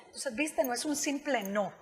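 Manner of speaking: ordinary talk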